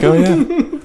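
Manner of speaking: put-on high cheeky "oh yeah" as the baby. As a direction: voice the baby teasing. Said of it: "being like".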